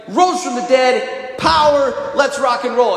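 high, hyped-up rallying cry; excited apostles. Acted. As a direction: play followers thrilled by a victory.

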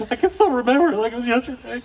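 high, quick, hammed-up emotion; mock-overcome. Dad relishing his moment of joy, mock-overcome. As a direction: play up being overcome by the memory.

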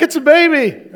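high, loud thrilled announcement; excited. Retold or imitated. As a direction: shout the news with glee. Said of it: "They're like".

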